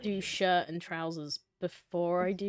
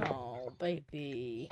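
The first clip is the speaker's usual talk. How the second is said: mock pity; 7 semitones lower, 10 dB quieter, much slower than usual. Pitying teammate with one thousand.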